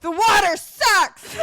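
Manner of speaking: laughing mock. Acting the water boy, laughing.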